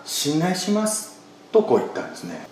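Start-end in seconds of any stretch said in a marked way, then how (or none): 0.0-1.2 soft, slow, simple trust; like a small child trusting a parent; childlike trust. Retold or imitated. Preacher voicing Mary's trust like a small child, soft and slow. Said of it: "まるで小さな子供が親を信頼しているように".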